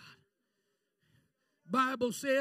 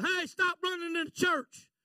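raised, higher pastor's holler; mock holler; 7 semitones higher, 9 dB louder than usual. Pastor to children running in church, mock holler. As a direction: imitate a pastor calling out a reprimand. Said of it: "and he hollers out".

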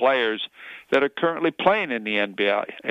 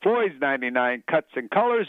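big pitched-up show opener; radio host opening; 6 semitones higher than usual. Acted. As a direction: kick off the show with energy. Host opens show with catchphrase.

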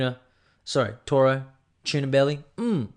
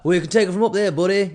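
higher, louder mock tough-guy voice; first go at the pundit's voice, higher and louder; Michael Bisping impression; 8 semitones higher, 7 dB louder, faster than usual. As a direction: puff up like a cocky fighter brushing off a fan. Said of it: "You know how he talks".

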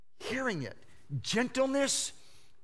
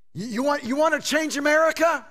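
high, loud question to the crowd; loud, impassioned preacher's question; rousing challenge; 7 semitones higher, 7 dB louder than usual. Real, not acted. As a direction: throw out the challenge, voice raised. Loud challenge between soft quotes.

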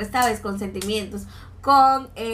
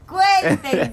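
bright, raised greeting to the audience; lively host calling out; excited call; 7 semitones higher, 4 dB louder than usual. Real, not acted. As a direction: call out to the listeners, higher and louder. Opening the podcast to listeners.

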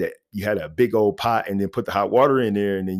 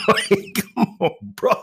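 laughing; 11 semitones higher than usual. Real, not acted. Laughing exasperation at relatives.

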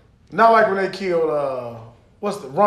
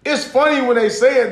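ordinary delivery

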